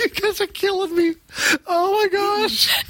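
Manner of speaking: breathless, helpless laughing; crying with laughter. Real, not acted. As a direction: laugh till it hurts. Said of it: "I am crying".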